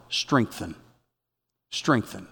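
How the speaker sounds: low, soft, as if waking with it; hushed inner word. Retold or imitated. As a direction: let the word sink in quietly. Word on his heart at night.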